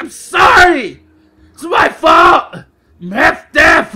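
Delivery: loud, high hollered apology; yelled apology. Retold or imitated. Half-deaf customer apologizing to the clerk, yelling. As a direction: holler the apology at full volume. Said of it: "I'm apologizing to him while I'm yelling".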